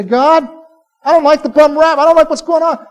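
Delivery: high, loud complaint in prayer; acted protest, pleading your case upward; real with God. Believer telling God about a bum rap, acted frank complaint. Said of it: "Be that real with him".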